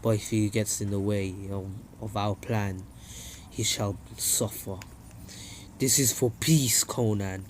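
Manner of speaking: low, soft, cold threat; Nagato's voice. Retold or imitated. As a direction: menace quietly from deep down. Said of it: "Nagato's like".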